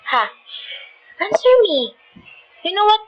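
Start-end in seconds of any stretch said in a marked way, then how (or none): 1.2-1.9 higher-pitched, frustrated demand; exasperated outburst at a silent partner. Acted. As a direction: insist on an answer, pitch jumping up. Acted quarrel, demands an answer.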